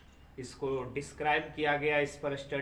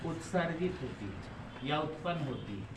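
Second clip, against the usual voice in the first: dictation. Acted. Teacher dictating notes slowly.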